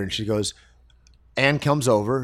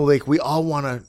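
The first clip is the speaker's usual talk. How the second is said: usual speaking voice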